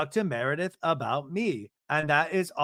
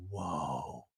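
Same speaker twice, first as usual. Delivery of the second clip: hushed, low, drawn-out retold whoa; stunned reaction; 11 semitones lower, 10 dB quieter than usual. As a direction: breathe it out softly as a stunned onlooker. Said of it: "everybody's just like".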